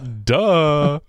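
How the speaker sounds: high mocking duh; sarcastic duh. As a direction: say it like a bratty teen.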